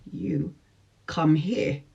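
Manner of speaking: soft, understated beckoning; cool summons. Retold or imitated. To the man calling her from his bike, coolly quiet.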